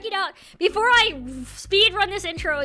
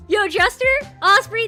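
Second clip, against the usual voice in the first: usual speaking voice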